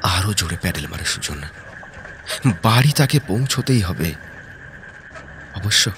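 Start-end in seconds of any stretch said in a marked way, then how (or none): none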